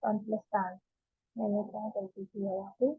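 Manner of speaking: low, hushed thinking aloud; puzzling to oneself. Real, not acted. Teacher hunting own mistake at the board, quiet self-talk. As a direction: drop low and soft, searching for the slip.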